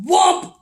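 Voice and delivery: sudden loud impact sound; loud whomp. Acted. Bear's paw striking Squirrel, loud whomp. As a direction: slam the word down hard.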